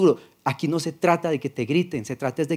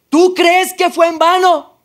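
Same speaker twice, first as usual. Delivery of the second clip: much higher, louder and slow, the voice thundering; voice raised further; 14 semitones higher, 10 dB louder than usual. Retold or imitated. The Lord asks him a second time, thundering raised voice. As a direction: repeat the rebuke even bigger, booming. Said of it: "Fue la única vez que me alzó la voz".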